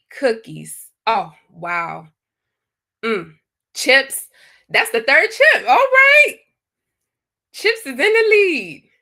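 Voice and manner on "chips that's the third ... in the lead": high and loud, cheering the chips on; excited game host. Real, not acted. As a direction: announce it like a scoreboard lead. Chips take the lead.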